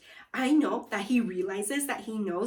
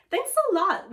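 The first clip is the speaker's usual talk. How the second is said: cheerful thanks; 9 semitones higher, 7 dB louder than usual. Acted. Kids thanking loudly.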